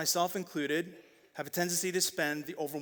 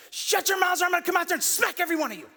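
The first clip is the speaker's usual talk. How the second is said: much higher, louder, fast screaming mom; mock furious yell; 14 semitones higher, 6 dB louder, faster than usual. Mom yelling at her kids in the hall, furious shout. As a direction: blast it like a mom losing it at the door. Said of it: "She goes".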